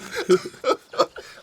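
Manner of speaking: high-pitched burst of laughter; real laugh. Real, not acted. Laughs at own whoop-de-doo.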